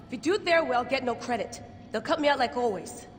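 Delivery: desperate loud protest; urgent plea. Acted. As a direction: stop her before she makes the call. Begging colleague not to report.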